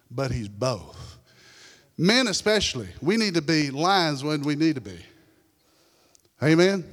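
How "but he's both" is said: quiet. Real, not acted. Quiet emphatic sermon point.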